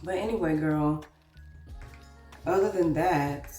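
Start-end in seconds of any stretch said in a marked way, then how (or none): none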